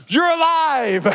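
husband laughing with relief to his wife; relieved laugh. Retold or imitated. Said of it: "I'm like".

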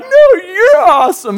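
high, loud, drawn-out gush; gushing compliment. Retold or imitated. Pastor imitating stoked surfers trading compliments, high and gushing.

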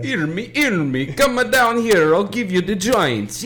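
a hammed-up Jamaican count luring you in; Jamaican Dracula. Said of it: "Jamaican Dracula".

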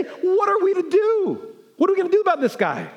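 lightly acted panicked leaders; mock panic.

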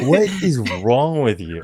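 playful imitation of his wife's disbelief; laughing mock outrage. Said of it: "She's like".

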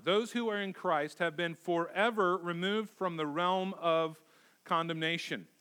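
ordinary talk

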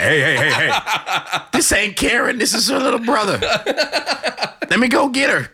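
high, laughing little-brother voice; squeaky boy calling his sister through laughter; high kid voice. Retold or imitated. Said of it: "I'm like".

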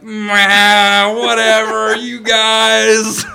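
loud, high, drawn-out brush-off; hammed-up waving away of a silly prank; mock dismissive. Groom waving off police again, loudly dismissive.

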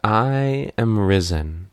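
slow, stretched-out mock-solemn declaration; drawn-out proclamation. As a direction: proclaim it slowly, stretching each word.

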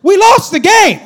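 high, loud protest; indignant shout. Retold or imitated. Kid protesting medals after a loss, loud and indignant. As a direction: shout that they lost.

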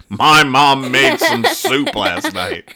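hammed-up Dennis reply; mock kid voice. Greeting people at the door, slow kid blurt.

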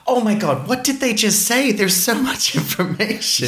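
high, fast bewildered outburst; mock flustered at fast dialogue; overwhelmed exclamation. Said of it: "I go".

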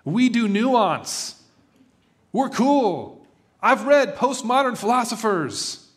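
a hammed-up cry to be seen as cool; mock shout. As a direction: protest to the whole city, half-joking. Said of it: "I wanted to shout to everybody in the city".

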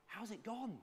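high, eager teacher asking; eager question. Retold or imitated. Excited teacher asking her pupil how the reeds went. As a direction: lift it up like an excited teacher checking in.

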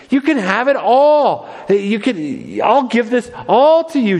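a pastor voicing Satan offering Jesus the crown, high and coaxing; tempting Satan. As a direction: pitch it up and sweet-talk like the devil dangling a prize. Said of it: "He's saying".

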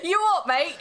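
high-pitched mock Chav challenge; Chav accent. Trading taunts with a British friend, mock Chav accent. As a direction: square up in a jokey Chav accent, half laughing. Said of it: "I'm good at a frickin' Chav accent".

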